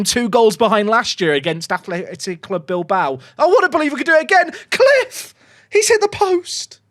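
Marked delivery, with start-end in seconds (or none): 3.4-6.8 high-pitched, urgent match call; will the shot in, voice shooting up; excited commentary. Real, not acted. Willing a shot in, near miss.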